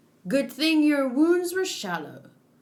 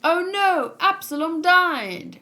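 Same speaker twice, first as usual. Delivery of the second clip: high, loud, drawn-out fake lament; hammed-up "oh no", pitched well up; mock dismay; 6 semitones higher, 4 dB louder than usual. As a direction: wail it in exaggerated mock grief. Mock dismay at manga character's death.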